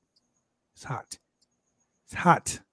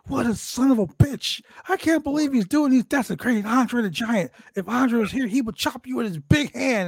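mock commentator, pitched up high; imitating commentators; 9 semitones higher than usual. Mocking the commentators' reaction, pitched high. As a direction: play the shocked announcer, voice up high. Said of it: "the commentary was like".

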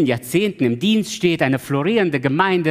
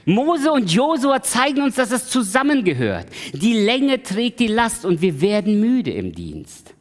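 preaching at full force; 6 semitones higher than usual. Acted. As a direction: hammer the point home from the pulpit.

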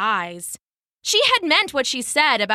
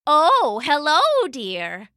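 put-on old woman voice; 5 semitones higher, 4 dB louder, slower than usual. Disguised goddess as old woman.

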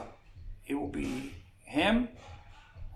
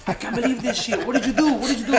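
higher, louder, laughing through the quote; retold excited yelling; 8 semitones higher, 8 dB louder than usual. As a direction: imitate the fighter yelling in disbelief and laughing.

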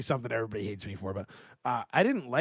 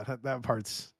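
ordinary delivery

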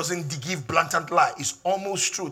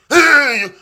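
sudden loud high hey; calling out; 9 semitones higher, 14 dB louder than usual. Acted. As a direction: grab their attention with a shout. Pastor calling out congregation.

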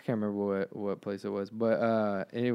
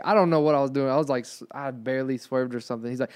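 ordinary talk

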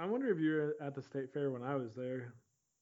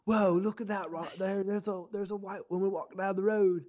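lightly put-on Australian accent, pitched up; a hammy Australian wildlife-host accent, not fully held; Steve Irwin; 5 semitones higher than usual. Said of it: "that was a horrible Australian accent".

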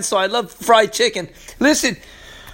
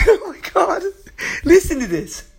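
laughing delight; 8 semitones higher than usual. Real, not acted.

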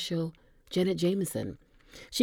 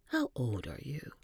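hushed, intimate question; whisper; 9 dB quieter than usual. Acted. Janet asking Dom in a close whisper. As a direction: lean in and breathe it.

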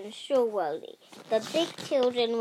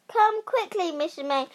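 raised, urgent summons, starting high; calling out; 6 semitones higher, 3 dB louder than usual. A narrator's reading. Wilma fetching the teacher, calling out. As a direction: call urgently to fetch someone. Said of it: "called Wilma".

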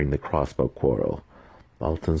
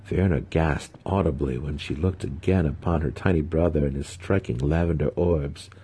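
usual speaking voice

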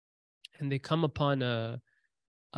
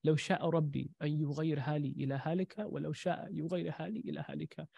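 quiet; 8 dB quieter, much faster than usual. Retold or imitated. Quoting the drunk man's words.

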